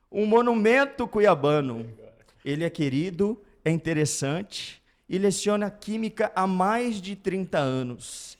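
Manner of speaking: loud show announcer introduction. Acted. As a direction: present the guest like a host.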